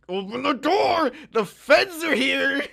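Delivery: high, loud, slow call through the door; urgent call. Retold or imitated. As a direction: draw it out loud and high to the roommate. Calling roommate, FBI at door.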